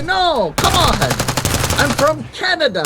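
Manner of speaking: hammed-up exasperated reply; mock frustration. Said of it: "So I get frustrated".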